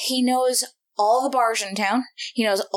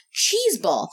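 usual speaking voice

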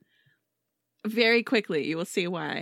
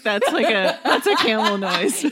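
ordinary talk

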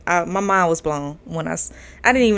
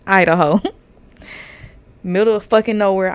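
ordinary talk, nothing marked

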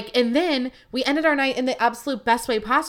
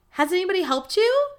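lightly voiced barista, pitched up; barista's query; 8 semitones higher than usual. Barista to an ignored customer, high questioning imitation. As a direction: ask it high, imitating the server.

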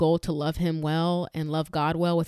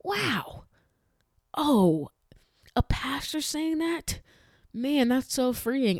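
high, slow, wide-eyed amazement; mock awed viewer; 8 semitones higher, much slower than usual. Mocking a relieved viewer who hears the pastor, awed and slow. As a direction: play a viewer dazzled by the news. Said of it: "millions of people watching this show thinking".

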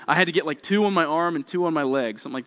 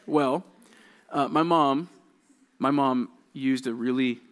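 normal talking voice

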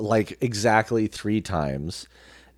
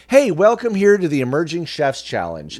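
higher and louder, a cheesy event host; hammed-up host opening a cooking contest; mock event MC; 7 semitones higher, 5 dB louder than usual. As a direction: do a canned emcee welcome. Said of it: "it's like".

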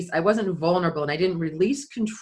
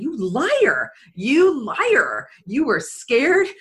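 higher and louder, slowing on the accusation; scolding inner voice; 8 semitones higher, 6 dB louder, slower than usual. Retold or imitated. Her inner voice accusing herself, higher, louder and scolding. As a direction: call yourself out, hard.

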